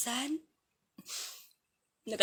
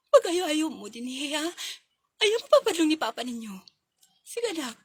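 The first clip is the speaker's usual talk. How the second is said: put-on a mother crying as she leaves her children; tearful farewell; 3 semitones higher, 7 dB louder than usual. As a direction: hold back sobs while instructing the kids.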